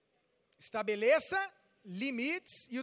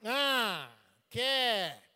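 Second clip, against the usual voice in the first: put-on sulky teen; 5 dB louder than usual. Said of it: "o menino sentou daquele jeito".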